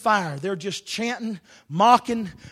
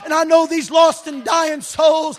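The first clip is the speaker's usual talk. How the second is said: building from strong preaching to a full shout, much higher and louder; fervent preaching; 6 semitones higher, 14 dB louder than usual. Acted. Preacher rousing his congregation, building to a roaring shout. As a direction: preach it like fire from the pulpit.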